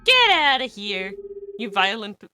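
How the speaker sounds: ordinary delivery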